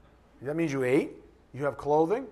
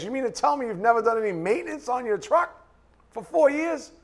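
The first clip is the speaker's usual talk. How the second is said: incredulous; 8 semitones higher, 4 dB louder than usual. Retold or imitated. Mechanic disbelieving the customer.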